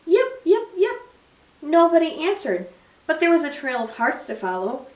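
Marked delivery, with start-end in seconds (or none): 0.1-1.0 high yipping fox call; fox yips. A narrator's reading. Little fox calling toward the music, high yips. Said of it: "She called".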